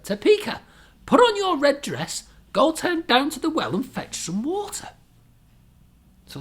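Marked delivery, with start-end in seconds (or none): 0.0-4.9 high, loud commanding mother; bossy mother. Acted. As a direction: order her about sharply. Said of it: "her mother said".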